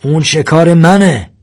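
high, forceful yell; shouted claim. A narrator's reading. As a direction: shout to claim what is yours. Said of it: "سرهنگ داد زد".

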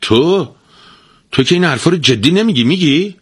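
high, fast, incredulous stammer; can't believe his ears; a stunned husband questioning his wife; disbelieving. A narrator's reading. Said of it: "با ناباوری گفت".

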